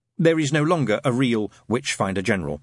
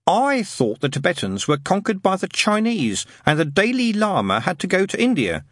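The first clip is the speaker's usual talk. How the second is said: put-on higher boy's voice, mildly tentative; unconvinced; 9 semitones higher than usual. A narrator's reading. Boy raises Tibetan news with friends, half-heartedly. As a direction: raise the objection without much conviction. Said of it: "said Wensleydale without much conviction".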